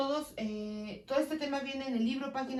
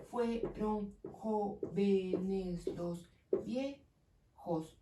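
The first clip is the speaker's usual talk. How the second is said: very slow syllable count; 2 semitones lower, much slower than usual. Shown as a demonstration. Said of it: "Ocho sílabas".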